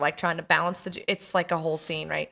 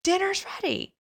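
raised voice; 14 semitones higher than usual. Retold or imitated. Brother rousing a sleeping man for dinner, raised voice. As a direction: imitate a brother calling someone to dinner. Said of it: "raises his voice".

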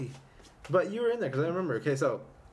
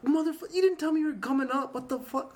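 mock outrage; 10 semitones higher than usual. Voicing the shocked reaction.

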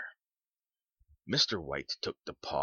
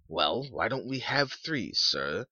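put-on pert, higher-pitched young man's challenge; clever; 6 semitones higher, 3 dB louder than usual. A narrator's reading.

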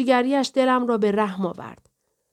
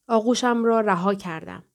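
ordinary delivery, nothing marked

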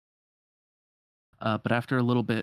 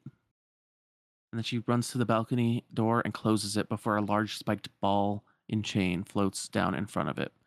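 ordinary delivery

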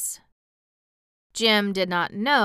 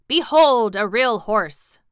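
loud, raised proclamation; proud cry; 4 semitones higher, 9 dB louder than usual. A narrator's reading. Cab horse shows himself off, crying out proudly. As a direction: announce it grandly at full voice. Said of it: "he cried".

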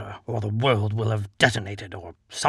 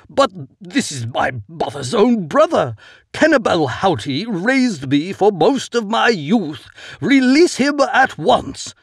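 pleading order; 7 semitones higher, 5 dB louder than usual. A narrator's reading.

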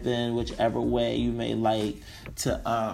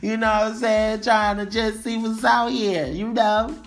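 put-on young guy bragging; 7 semitones higher, 7 dB louder than usual. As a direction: hype yourself up, loud and high. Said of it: "So mine would be like".

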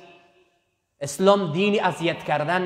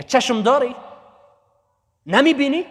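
sharp, louder scolding rebuke, pitched higher; angry scolding; 7 semitones higher, 5 dB louder than usual. Retold or imitated. Bodyguard scolds dervish whose leg tripped the caliph, angry rebuke. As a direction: snap at him for tripping the caliph.

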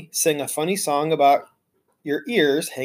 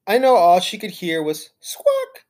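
plain reading voice

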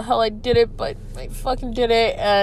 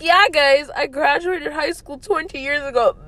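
mock future self; 7 semitones higher than usual. Podcaster imagining herself twenty years on, mock-cheerful. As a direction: play her older self, half-joking. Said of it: "Being like".

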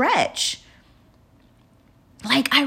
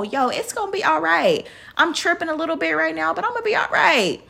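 self-reassurance; 6 semitones higher than usual. Retold or imitated. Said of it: "you gotta let yourself know".

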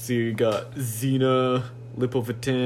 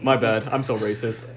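normal talking voice

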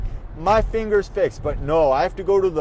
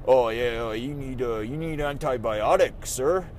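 a lower, bored doctor voice; mocking doctor; 8 semitones lower, 4 dB quieter than usual. Annoyed patient mimicking his doctor, lower and dismissive. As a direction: drone it like an unimpressed doctor. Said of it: "the doctor looks at my finger and is like".

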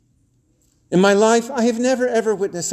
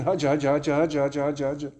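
rapid repetitive prayer mumble, fading away; mock praying; 3 semitones lower, 5 dB quieter, faster than usual. Boy trying to look religious in the waiting room, mock praying. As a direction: imitate someone making a show of praying.

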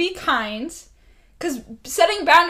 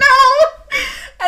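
squeaky no; 8 dB louder than usual. Retold or imitated. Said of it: "I was like".

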